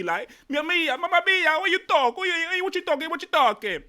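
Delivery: high, hammed-up Italian accent. Shown as a demonstration. Host trying to win the challenge, cartoonish Italian accent. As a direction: rant in an exaggerated, high-pitched Italian accent. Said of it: "should we ride with Italian".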